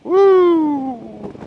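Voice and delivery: loud high whoop of joy; excited whoop. Real, not acted. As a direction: let out a big happy woo. Riding off, happy shout.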